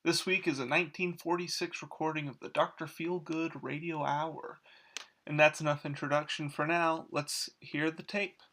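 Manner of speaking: soft, measured host intro; understated presenter read; podcast host introduction. Acted. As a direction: introduce an archive show in a hushed, even voice. Podcast host intro over music.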